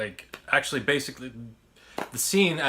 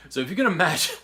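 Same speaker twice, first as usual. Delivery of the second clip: laughing through the words, higher and louder; Butthead laugh; 7 semitones higher, 6 dB louder, faster than usual. Retold or imitated. Narrator imitating the bowler hat guy, laughing. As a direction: chuckle it out like a cartoon teen. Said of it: "that was my impression".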